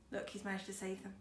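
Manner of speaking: usual speaking voice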